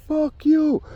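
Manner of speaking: high, sudden squeal of an insult; mock shrieked curse; retold shout. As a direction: fling it out in a high yelp.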